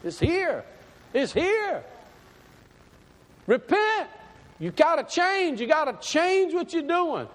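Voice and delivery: high-pitched prophet's cry; urgent street preaching. Acted. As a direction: cry out for repentance in short bursts. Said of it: "John just kept preaching".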